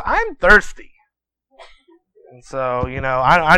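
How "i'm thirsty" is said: put-on high-pitched child's plea; thirsty little boy. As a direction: pipe up higher like a kid in bed. Boy in bed complains to mother.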